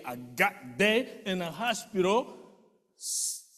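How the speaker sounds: normal talking voice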